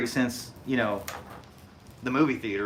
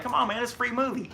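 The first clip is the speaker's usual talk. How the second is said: friend's voice; 11 semitones higher, faster than usual. Retold or imitated. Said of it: "he's like".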